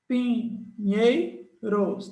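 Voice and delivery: very slow word split into syllables. Shown as a demonstration. Teacher checking the split with students, very slow. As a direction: spell out each syllable slowly. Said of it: "tá certo".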